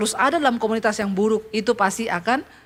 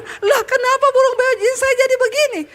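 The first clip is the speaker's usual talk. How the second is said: dismayed pastor; 12 semitones higher than usual. Acted. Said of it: "Pak Pendeta bilang".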